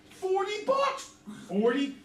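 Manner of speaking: can't believe the windfall; delighted disbelief. Retold or imitated. Boy picturing forty bucks a month, squealing with glee. Kid stunned by imagined riches.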